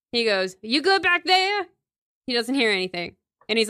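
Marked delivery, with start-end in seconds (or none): 0.6-1.7 high-pitched call to the back; acted sheriff checking on someone behind him. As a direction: call over your shoulder, pitched way up. Sheriff calls back to inmate.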